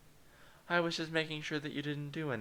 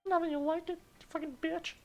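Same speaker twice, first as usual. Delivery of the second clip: put-on sassy high falsetto girl; snooty anime girl, very high; high girly voice; 8 dB louder than usual. Character voice again.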